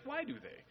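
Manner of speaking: "Why do they" pitched high, then falling; high, questioning lift on WHY in "Why do they"; emphatic question. Shown as a demonstration. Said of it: "asks those as a question".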